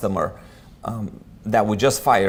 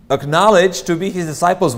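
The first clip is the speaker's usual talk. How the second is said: emphatic; 6 semitones higher, 6 dB louder than usual. Real, not acted. Preaching emphasis on disciples.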